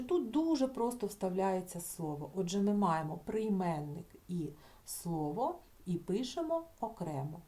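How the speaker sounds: usual speaking voice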